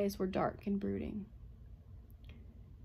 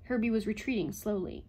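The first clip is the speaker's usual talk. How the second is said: plain reading voice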